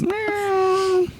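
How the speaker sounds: very high cartoon cat meow. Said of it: "I'm laughing at your meow face".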